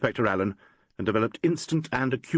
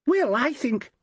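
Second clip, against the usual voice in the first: Valerie protesting; 13 semitones higher, slower than usual. A narrator's reading.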